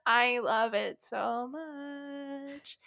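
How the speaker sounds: high, very drawn-out gush; overflowing excitement. Real, not acted. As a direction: stretch every word out with real adoration. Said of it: "trying to contain my excitement right now".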